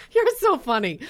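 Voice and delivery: high laughing voice. Real, not acted. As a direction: laugh out the line. Host laughing.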